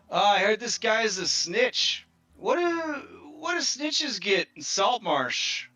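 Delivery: crowd-rousing heckler. Acted. Krebs taunting the crowd about the snitch, high mocking call. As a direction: work the crowd like a pit boss. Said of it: "you hear Krebs' voice over the cheering".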